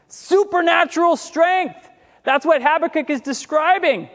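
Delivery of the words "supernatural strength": raised, slow shouted repeat; preacher echoes the line to the congregation, shouting it; preaching shout. Acted. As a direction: ring it out from the pulpit.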